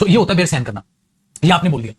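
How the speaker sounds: ordinary delivery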